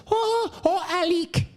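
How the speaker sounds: high, squealing fake Spanish wife voice; hammed-up Spanish accent, very high; mock Spanish accent. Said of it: "she says".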